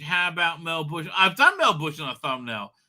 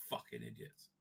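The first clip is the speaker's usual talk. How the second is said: much softer muttered insult under the breath; 23 dB quieter than usual. Real, not acted. Grumbling about the producers, a muttered insult. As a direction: mumble it down low.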